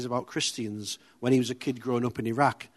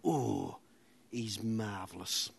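put-on soft, slow, lower old-man murmur; muttered old man; 4 semitones lower, 11 dB quieter, much slower than usual. Old man turns to his wife about the speaker, slow low murmur. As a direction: savour the word quietly.

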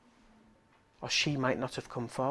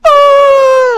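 very loud high wail; imitated crying outburst; 23 dB louder than usual. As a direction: imitate someone bursting into tears, full force. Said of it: "and burst out crying".

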